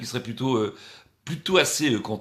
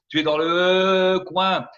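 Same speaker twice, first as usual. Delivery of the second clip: drawn-out call; 4 semitones higher, 6 dB louder, much slower than usual. Pretend. Calling out to absent guest.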